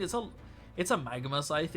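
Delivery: normal talking voice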